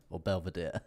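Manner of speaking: mock British accent. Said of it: "like, British in an accent".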